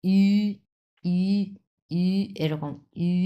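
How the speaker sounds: ই as a long, tight rounded ü; held, pursed ই demo; pressure on the jaws. Shown as a demonstration. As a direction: squeeze out a sustained ü for ই. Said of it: "দুই চোয়ালে যত pressure দিবেন".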